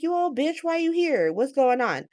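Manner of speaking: high-pitched mock indignation; indignant confrontation. [name] confronting Drew as he busts in, indignant.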